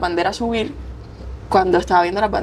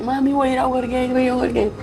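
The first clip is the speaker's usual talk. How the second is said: higher, joyful tearful announcement; excited news; 5 semitones higher than usual. Retold or imitated. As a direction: tell mom the big news, overjoyed. Calling mom crying with joy. (noticia emocionada, más aguda)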